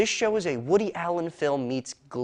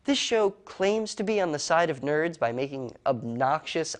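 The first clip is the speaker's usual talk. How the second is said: normal talking voice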